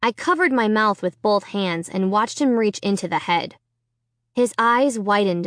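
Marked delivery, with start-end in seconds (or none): none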